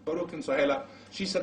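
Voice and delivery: normal talking voice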